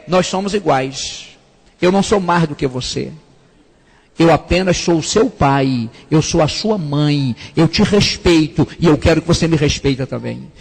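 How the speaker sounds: calm, level parent. Shown as a demonstration. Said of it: "parent speaks eye to eye".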